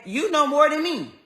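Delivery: mocking echo. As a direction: throw the doctor's line back in scorn. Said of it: "he said".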